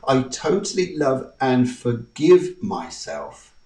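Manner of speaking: slow, earnest affirmation. Shown as a demonstration. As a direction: say it slowly, meaning every word. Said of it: "we go and say here".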